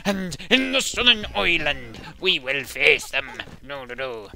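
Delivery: loud, high Northern Irish political tirade; Northern Irish politician. Acted. Gamer mimicking an angry DUP speaker, loud Northern Irish accent. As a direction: build into a booming Northern Irish hardliner's speech. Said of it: "things that are happening in Northern Ireland".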